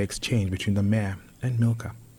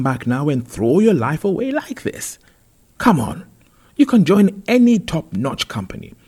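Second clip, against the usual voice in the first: loud, high-pitched parental reproach; exasperated scolding; 5 semitones higher, 7 dB louder than usual. Acted. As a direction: scold with big, emphatic swoops in pitch. Parent berating son in story.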